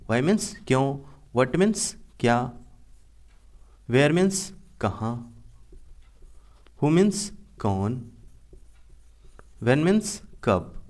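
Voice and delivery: slow, spaced-out dictation pace. Shown as a demonstration. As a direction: spell each meaning out slowly for students to copy. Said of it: "slow dictation of word meanings". (धीमी गति से लिखवाना)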